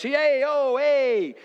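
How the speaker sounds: playful high-pitched "hey, oh" impression; loud Italian-American son. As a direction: imitate an Italian family member calling out. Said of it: "little bit Italian and a little bit".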